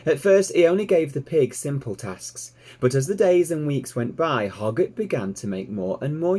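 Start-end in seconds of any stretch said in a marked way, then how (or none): none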